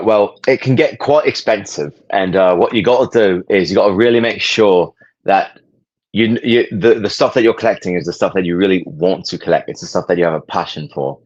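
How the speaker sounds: put-on British accent, measured and careful. Guest giving collecting advice on request, British accent. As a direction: answer as a mock-proper British collector. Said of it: "in a British accent".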